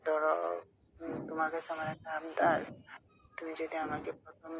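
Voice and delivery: normal talking voice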